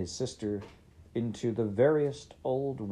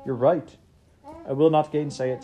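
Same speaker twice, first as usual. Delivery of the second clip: louder, higher outcry; cried out; 5 semitones higher, 6 dB louder than usual. Acted. Scrooge answering the ghost, a louder, higher outcry. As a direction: cry out in earnest agreement. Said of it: "cried Scrooge".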